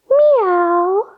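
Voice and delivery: high, long-held meow; cat meow. Shown as a demonstration. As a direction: meow high on a full breath. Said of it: "Take a deep breath in and say".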